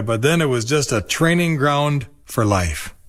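ordinary delivery, nothing marked

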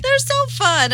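squealed high, stretched delight; gushing. Real, not acted. Gushing again about ponies.

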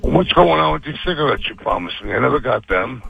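normal talking voice

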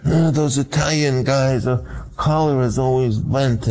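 a lightly voiced Brando, musing aloud; half-imitating the actor's musing tone; a hint of Brando trying on a character; Marlon Brando musing. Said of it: "he said".

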